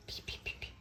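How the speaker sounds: whispered peeping. Real, not acted.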